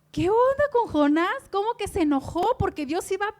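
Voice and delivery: "¿qué onda con Jonás?" called out high and loud in disbelief; baffled outcry. Real, not acted. Preacher to congregation, baffled by Jonah's anger, loud disbelief. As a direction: throw the question out, loud and astonished.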